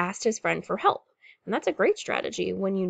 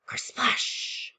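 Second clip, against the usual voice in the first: higher, whispery crash of water; storybook splash sound; 4 semitones higher, 3 dB quieter than usual. A narrator's reading. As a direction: let it hiss out like a breaking wave.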